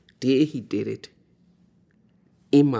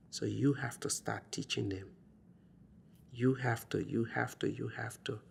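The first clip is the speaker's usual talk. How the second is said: softly pressed advice, fading away; quiet insistence; 7 dB quieter than usual. Retold or imitated. Hospital roommate urging mother to teach her children, quiet insistence. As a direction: repeat it gently, getting softer.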